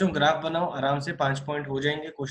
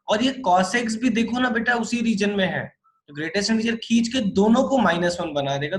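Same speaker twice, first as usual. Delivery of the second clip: excited explaining; 7 semitones higher, 5 dB louder than usual. Real, not acted. Teacher stressing key step.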